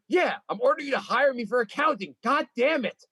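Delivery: loud, cursing demand; angry demand. Pretend. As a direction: yell it like an angry customer. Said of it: "And curse when you ask".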